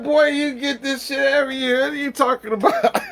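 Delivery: high, loud quote through laughter; laughing quote. Retold or imitated. Quoting the aunt while laughing hard. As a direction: squeeze her words out laughing. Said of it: "She said".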